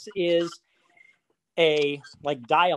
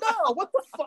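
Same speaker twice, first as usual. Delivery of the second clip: stunned. Retold or imitated. Snubbed speaker reacts.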